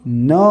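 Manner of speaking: "न" as nuh, not en; phonic sound of N. Shown as a demonstration. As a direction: sound out "न" low, with a neutral vowel. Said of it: "एनचा उच्चार आहे".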